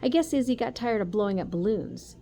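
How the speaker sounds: ordinary delivery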